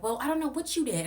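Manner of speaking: high mock voice of the accusing host. Voicing the host in argument.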